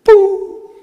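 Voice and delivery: playful boo chasing him off; mock booing. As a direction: jeer at Satan like a crowd. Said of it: "And then the Lord will go".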